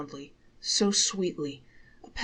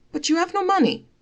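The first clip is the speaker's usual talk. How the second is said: high, louder wife's protest; objecting wife; 13 semitones higher, 7 dB louder than usual. Acted. Wife protesting to her husband, high and louder. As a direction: push back in a high wife's voice.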